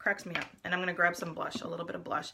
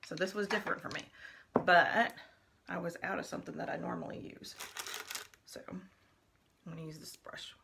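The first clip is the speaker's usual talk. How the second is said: usual speaking voice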